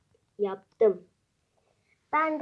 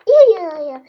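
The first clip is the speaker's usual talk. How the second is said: loud yelling; 5 semitones higher, 14 dB louder than usual. Real, not acted. Loud exclamation.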